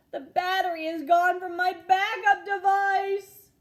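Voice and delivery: put-on loud, high, panicked boy's shout; panicked cry. Boy discovering his toy's battery is missing, shouting in panic. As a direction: cry it out as if the world ended.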